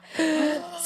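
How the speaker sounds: quick high gasp of disgust; sharp gasp. Retold or imitated. Recalling her stitch ripping open, a sharp gasp. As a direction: suck in air as if seeing the wound. Reliving stitch pulling loose.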